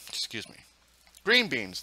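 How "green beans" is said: excited. Real, not acted. Excited naming of food.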